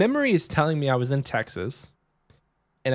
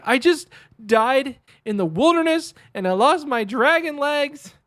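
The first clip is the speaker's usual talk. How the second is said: very high, loud hammed-up wail; mock crying; 13 semitones higher, 6 dB louder than usual. Kid telling mom about the lost dragon legs, mock crying wail. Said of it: "I cried on the inside".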